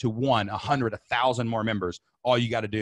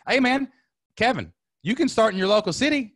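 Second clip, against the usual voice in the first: excited; 9 semitones higher, 5 dB louder than usual. Real, not acted. Hyped reply to a viewer.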